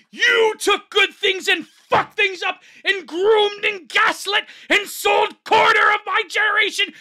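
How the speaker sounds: high, loud furious rant; worked up. Real, not acted. Streamer raging at the thread's poster, worked-up shouting. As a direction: shout it in a rising fury at the culprits. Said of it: "I'm getting worked up here".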